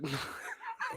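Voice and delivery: a soft, very high burst of giggling; high laugh. Real, not acted. Laughing at Lisa's denial, a high giggle. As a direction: crack up at how dumb it is.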